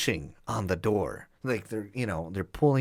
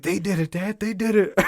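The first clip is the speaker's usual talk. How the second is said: higher, rising mock tattle; tattling uncle; 9 semitones higher, 4 dB louder than usual. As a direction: point the finger like a snitching kid. Uncle blames the boys to dad.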